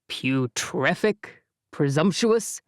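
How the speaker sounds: Jake's voice. Acted. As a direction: savor each silly word.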